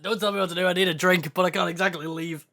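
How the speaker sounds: ordinary talk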